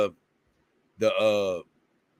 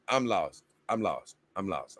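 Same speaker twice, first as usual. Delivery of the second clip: each repeat softer and lower, dying away; confused streamer fading out, softer each time; 5 semitones lower, 7 dB quieter than usual. Real, not acted. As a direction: let it trail off into nothing. Repeats phrase, trailing away.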